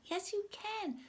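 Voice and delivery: high-pitched cartoon insistence; insistent retort. Friend urges Gerald to try, high insistent retort. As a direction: insist right back in a high voice. Back-and-forth story quarrel.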